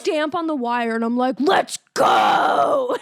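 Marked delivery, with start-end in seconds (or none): 1.4-3.0 loud, cracking cry of let's go; yelled taunt. Retold or imitated. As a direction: yell it with a crack in the voice. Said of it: "I'm just going nuts".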